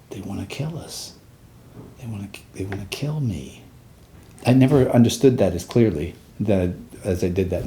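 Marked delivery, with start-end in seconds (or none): 0.1-3.6 much softer, almost to himself; hushed realization. Retold or imitated. Soldier realizing the enemy wants him dead, hushed. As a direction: let it dawn on you quietly. Retold dawning realization of danger.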